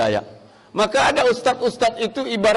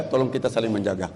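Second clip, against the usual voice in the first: soft repeated plea; 9 semitones lower, 6 dB quieter, faster than usual. Shown as a demonstration. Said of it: "tolong, tolong".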